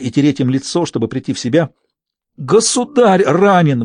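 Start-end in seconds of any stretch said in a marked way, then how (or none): 2.4-3.8 slow, high, anguished moan; despairing moan. A narrator's reading. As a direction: draw it out in despair. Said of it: "в отчаянии простонал". (в отчаянии, стонущим голосом)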